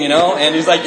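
ordinary delivery

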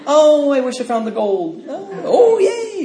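high, laughing, childish squeal of fake joy; mock wishful squeal. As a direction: ham up a silly daydreamer. Mocking childish wishful thinking.

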